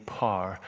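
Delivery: "power" said as one syllable, "par", in a Northern Ireland accent; Northern Irish one-syllable power. Real, not acted. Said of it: "saying power with just one syllable".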